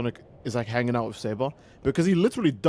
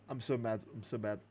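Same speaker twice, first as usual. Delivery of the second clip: quiet; 5 semitones lower, 14 dB quieter than usual. Real, not acted. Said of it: "let me slow down".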